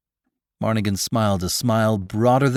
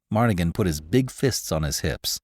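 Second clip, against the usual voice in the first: usual speaking voice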